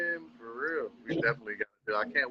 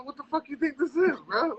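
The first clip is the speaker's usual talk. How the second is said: mock retort; 12 semitones higher than usual. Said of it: "Like, yo".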